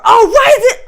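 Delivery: high, very loud mock wail; mock angry outburst. Host imitating their constant flare-ups for the audience, comically mad. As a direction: flare up in hammed-up frustration. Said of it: "think of me as mostly mad".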